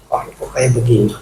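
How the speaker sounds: a hushed, saddened lament; sad sigh. Retold or imitated. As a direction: let it fall quietly in sorrow. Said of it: "baru saya sedih".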